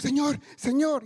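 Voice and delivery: a hammed-up plea; mock pleading. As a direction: imitate people crying out to God.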